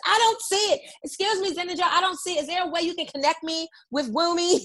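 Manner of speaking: high-pitched put-on customer enquiry; confused customer. Friend acting out customers searching the business page, high and plaintive. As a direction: mimic a client asking for help, pitched well up. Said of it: "everybody's gonna go right to your business".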